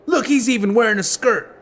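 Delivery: high-pitched playground taunt; jeering kid. A narrator's reading. Gang kid mocks Link's clothes, jeering. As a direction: egg on the gang with mockery. Gang kids jeer at Link.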